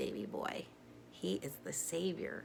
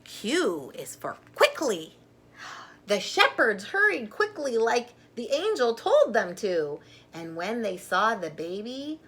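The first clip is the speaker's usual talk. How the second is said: lively, louder storybook reading; reading to children; 4 semitones higher, 9 dB louder than usual. Acted. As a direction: read it out like a picture book for little ones. Reading alphabet book aloud.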